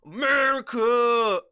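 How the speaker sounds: loud, high chant of America!; patriotic yell. Acted. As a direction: bellow it like a proud fan. Patriotic cheer.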